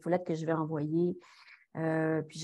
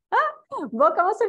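laughing yelp, much higher and louder; surprised laugh; 14 semitones higher, 5 dB louder than usual. Real, not acted. Asked if she is Pierre-Luc's sister, amused surprise.

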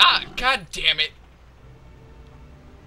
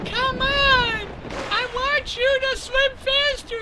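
very high squeaky falsetto; put-on high, whiny urging; impatient female character. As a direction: nag in a mock female falsetto. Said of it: "She's like".

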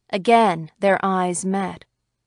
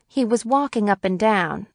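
ordinary delivery, nothing marked

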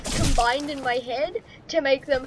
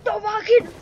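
loud, high war cry; scream; 5 semitones higher, 5 dB louder than usual. Shown as a demonstration. Gamer showing how to fight, shouted war cry. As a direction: scream it on each attack.

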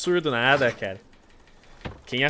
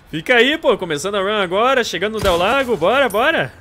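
loud eager push, pitched up; hyped up; 5 semitones higher, 5 dB louder than usual. Real, not acted. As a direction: fire yourself up for the run. Hyped starting the speedrun.

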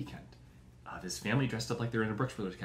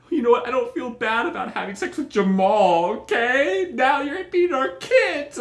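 loud, high, furious mock scream; wife yelling back at her husband; 12 semitones higher, 11 dB louder than usual. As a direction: shriek it at full volume like a betrayed wife.

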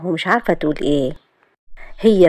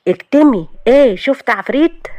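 harsh hushing; 7 semitones higher, 4 dB louder than usual. Acted. Grabs her mouth, hushing her.

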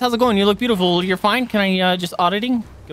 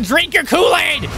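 shouted taunt; 8 semitones higher, 5 dB louder than usual. Real, not acted. Yelling mid-fight in game.

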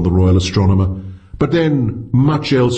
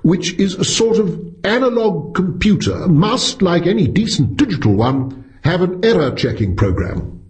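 higher, eager, pressing the point; eager pounce; 8 semitones higher than usual. A narrator's reading. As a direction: jump on the argument like catching a mistake. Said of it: "Wright pounced".

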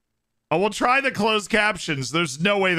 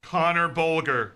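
usual speaking voice

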